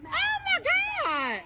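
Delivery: drawn-out shriek way up high and slow; slow, high-pitched shout of shock at the video. Real, not acted. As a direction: scream it out in disbelief. Shocked by the clip.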